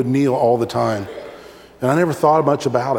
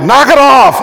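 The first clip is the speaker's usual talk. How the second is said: full-volume high shout at the table; parent yelling; 8 semitones higher, 13 dB louder than usual. Acted. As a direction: blast it at fidgeting kids. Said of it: "you're like".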